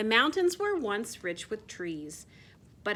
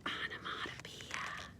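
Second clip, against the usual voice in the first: whispered answer to the class; 12 dB quieter than usual. Shown as a demonstration. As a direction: breathe the word out like a secret. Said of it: "What do we call this?".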